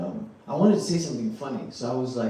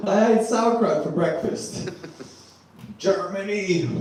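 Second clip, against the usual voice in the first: laughing boast; 6 semitones higher, slower than usual. Pretend. Proving Germanness, laughing.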